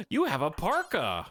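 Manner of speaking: sudden delighted discovery; surprised gasp. Real, not acted. Gasps at colonist's clothes.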